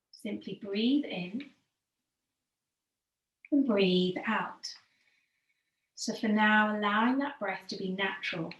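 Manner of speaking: calm yoga teacher easing the class into stillness; guided meditation. Acted. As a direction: guide them gently into the breath, letting phrases sink at the end. Leads breathing meditation.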